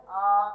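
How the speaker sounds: आ held out as a long vowel; long vowel demo. Shown as a demonstration. Teacher showing students how to sound a long vowel, with आ drawn out. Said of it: "इसका उच्चारण कैसे कहेंगे".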